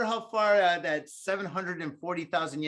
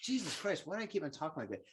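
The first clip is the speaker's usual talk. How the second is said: much softer aside to yourself; muttered self-reproach; 9 dB quieter than usual. Retold or imitated. Host retells scolding himself after a show, quiet self-reproach. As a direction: drop the volume and grumble inwardly.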